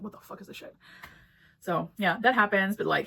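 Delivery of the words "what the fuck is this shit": whispered, stunned swear; disbelieving whisper. Retold or imitated. Reader reacting to Andrew's lack of self-respect, whispered disbelief. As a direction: breathe it out in shock. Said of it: "I'm just like".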